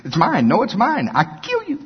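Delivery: hammed-up tug-of-war, climbing to a high squeak; mock childish threat, pitch jumping up; squabbling children. Acting out children's squabble grown bigger.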